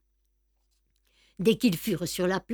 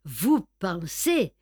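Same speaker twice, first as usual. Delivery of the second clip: ordinary delivery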